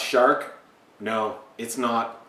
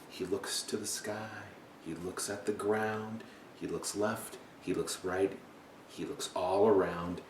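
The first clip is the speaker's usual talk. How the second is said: soft, low storytelling; quiet, low read-aloud narration; hushed suspense; 4 semitones lower, 12 dB quieter than usual. A narrator's reading. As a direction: lower your voice to build suspense.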